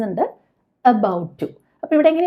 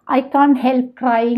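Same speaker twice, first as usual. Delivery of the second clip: drawn-out, word by word; slow model sentence; much slower than usual. Shown as a demonstration. Teacher showing learners the English sentence, very slow. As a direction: dictate it slowly to the class. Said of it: "teacher models sentence".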